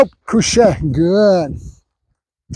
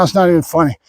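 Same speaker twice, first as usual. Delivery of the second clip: ordinary talk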